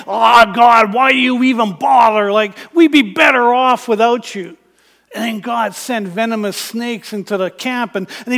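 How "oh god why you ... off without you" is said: louder, exasperated complaint at God; frustrated grumbling. Acted. Israelites in the wilderness grumbling at God, louder and exasperated. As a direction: whine it out like a fed-up crowd. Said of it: "grumbling and angry at God and frustrated and saying".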